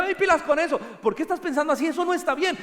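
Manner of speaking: lightly voiced wife, high and chiding; wife scolding. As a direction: play the wife catching him out, half laughing. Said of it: "Mi esposa me dice".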